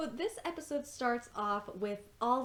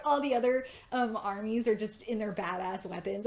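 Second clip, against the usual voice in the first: ordinary talk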